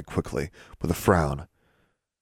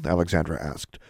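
usual speaking voice

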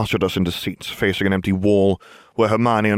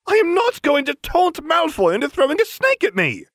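high, indignant refusal thrown back at them; shouted. Acted. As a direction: shout it out, fed up. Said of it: "Hermione shouted".

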